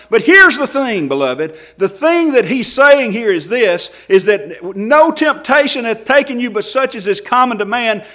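loud, driving sermon climb; fiery pulpit preaching; preaching at full force. Acted. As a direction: preach it hard to the congregation.

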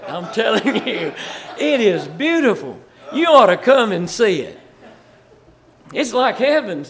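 laughing loud praise; laughing enthusiasm. Real, not acted. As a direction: gush about the state with laughter. Praises Virginia.

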